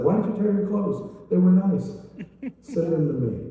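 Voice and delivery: teasing prophet. Acted. Prophet mocking the torn clothes.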